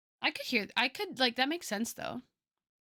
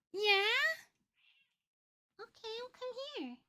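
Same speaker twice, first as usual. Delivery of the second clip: cat voice; 3 dB quieter than usual. Real, not acted. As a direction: coo sweetly to your cat in a tiny high voice. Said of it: "Sorry about my cat voice".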